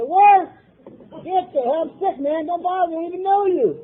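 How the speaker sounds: high, loud retorting yell at the stranger; mock yell. Sick patient yelling at the sponsor to leave. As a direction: snap back, pitched high and loud.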